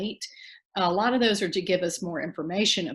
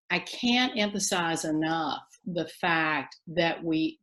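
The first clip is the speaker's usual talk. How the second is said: usual speaking voice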